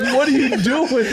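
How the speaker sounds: high, drawn-out question through laughter; incredulous. Retold or imitated. Quarterbacks baffled at Dennis talking back, incredulous. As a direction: imitate baffled teammates, nearly cracking up. Retelling teammates' disbelief.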